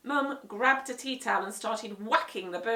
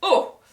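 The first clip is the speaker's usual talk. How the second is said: pained oh; 9 semitones higher, 15 dB louder than usual. A narrator's reading. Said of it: "said Mister Mossy".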